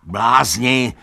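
furious donkey shouting at the animals; angry shout. A narrator's reading. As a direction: yell it at the fools. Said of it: "křičel Benjamin".

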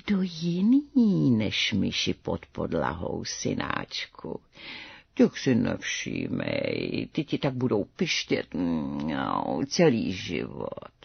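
low, soft, slow feline croon; meowing mother cat. A narrator's reading. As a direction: stretch the vowels like a purring, meowing cat. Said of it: "A jeho černá maminka mňouka".

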